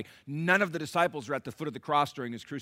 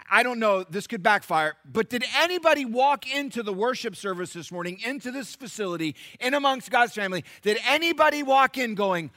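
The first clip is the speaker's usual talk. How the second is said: loud, high, driving sermon delivery; full-force preaching; 11 semitones higher, 11 dB louder than usual. Acted. Pastor preaching hard to his congregation, full force. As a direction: preach it out to the whole church.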